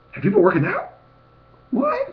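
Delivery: high, squeaky mock-astonished voice; family in disbelief. Fitness vlogger mimicking family seeing his weight loss, high surprised voice. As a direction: imitate them gasping in surprise. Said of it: "they looked at me like".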